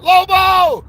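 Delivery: yelling for a friend after breaking it again; mock panicked yelling. The player shouting for Lobo in a high mock panic. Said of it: "I'll be like".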